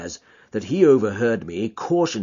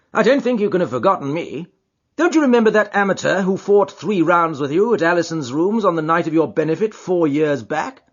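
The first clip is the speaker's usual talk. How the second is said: friendly, brisk gentleman; genial Holmes voice; 8 semitones higher, 6 dB louder than usual. Acted. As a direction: jog his memory cheerfully.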